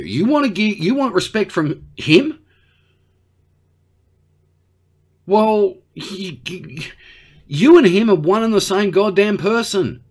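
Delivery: high-pitched indignant telling-off; exasperated berating. Acted. Australian telling Xi he is just like Trump, exasperated.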